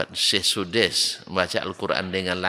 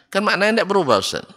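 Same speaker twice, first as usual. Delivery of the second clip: lightly acted objection in a higher, louder voice; mock objector; 11 semitones higher, 4 dB louder, faster than usual. Imagined pupil objects to the Ustaz, lightly acted protest. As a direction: put on a pupil's high-pitched protest.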